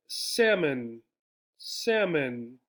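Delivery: "Salmon" said SAM-un, the L dropped; silent L. Shown as a demonstration. Teacher repeats "salmon" for the class, no L. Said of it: "sounds like the word without the L".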